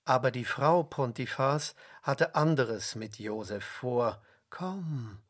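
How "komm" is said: hushed, luring invitation; whispered. A narrator's reading. Pontifar's wife whispers to Josef, seductive whisper. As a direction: softly coax him closer. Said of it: "flüsterte sie ihm zu".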